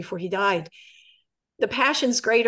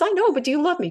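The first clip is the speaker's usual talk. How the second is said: raised, pressing plea; insistent husband; 7 semitones higher, 5 dB louder, much faster than usual. Acted. Husband asks his wife once more, insistent. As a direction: press the question, pitched up. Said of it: "And he says".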